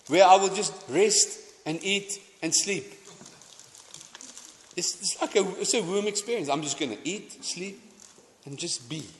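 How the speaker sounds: ordinary talk, nothing marked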